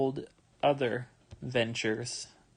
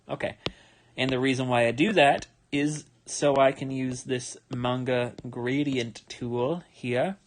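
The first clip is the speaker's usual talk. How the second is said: ordinary delivery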